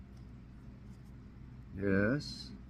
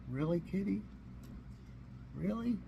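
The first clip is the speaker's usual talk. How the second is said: high, softer incredulous "really"; playful shocked question to the cat; mock disbelief; 6 semitones higher, 11 dB quieter than usual. Real, not acted.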